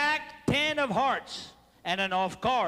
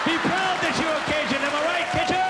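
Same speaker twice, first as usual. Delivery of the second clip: rousing cheer; 4 semitones higher, 4 dB louder than usual. Real, not acted. Rousing the Cajun crowd.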